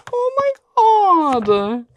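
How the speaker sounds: a high cry of delight; nearly in tears with joy; emotional cry. Real, not acted. Seeing the favourite book's art, a high cry. Overcome by the set.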